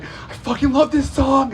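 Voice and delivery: put-on high, loud drunken squeal; obnoxious drunk friend. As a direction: belt it out like an overexcited drunk. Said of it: "she's being obnoxious".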